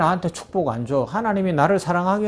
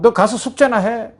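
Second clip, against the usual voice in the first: loud, higher mock scolding; scolding mother; 4 semitones higher, 3 dB louder than usual. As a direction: shoo the child off to study. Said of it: "윽박 지르고".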